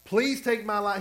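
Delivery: Jonah, fed up, telling God to take his life, mock angry; ticked-off plea. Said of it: "He's so ticked off".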